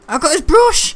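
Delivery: high, loud triumphant squeal; excited squeal. Real, not acted. Gamer grabbing loot, high excited squeal. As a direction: crow over the prize.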